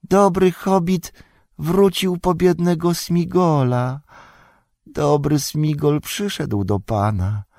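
high, slow creature voice; Golum, fawning. Acted. Golum welcoming his master and urging him away, high fawning whine. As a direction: wheedle in a high, drawn-out whine.

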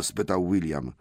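ordinary delivery, nothing marked

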